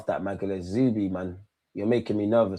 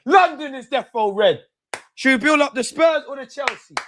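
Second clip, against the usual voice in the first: excited gloating; 11 semitones higher, 4 dB louder than usual. Real, not acted. Gloating after the win.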